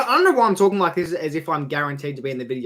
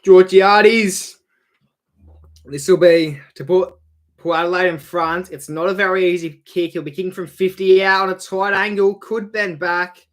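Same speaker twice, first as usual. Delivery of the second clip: loud, rising sports commentator call; live play-by-play call; 5 semitones higher, 9 dB louder than usual. Acted. Streamer calling a shot at goal, loud commentator style.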